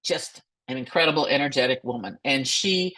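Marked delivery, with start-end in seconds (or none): none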